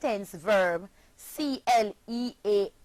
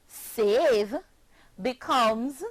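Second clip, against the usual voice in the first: ordinary talk, nothing marked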